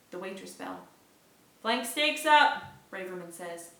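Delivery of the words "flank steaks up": calling order. Acted.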